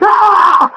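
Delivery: sudden high, loud shriek; mock shriek. Imitating his co-host's reaction to the trade news, shrieking. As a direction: shriek like a fan hearing bad news. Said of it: "You're like".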